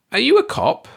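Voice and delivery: put-on wary old landlady blurting it out; suspicious landlady. A narrator's reading. Landlady questioning the visitor, wary and high.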